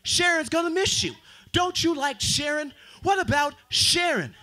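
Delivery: high, slow pleading voice; Facebook pleading. Retold or imitated. Facebook begging the comedian not to leave, high pleading voice. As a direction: beg like a clingy app. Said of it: "Facebook said".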